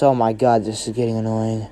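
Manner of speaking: low, muted irritation; annoyed. Acted. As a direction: grumble it low and soft, fed up. Deku bored by their attack.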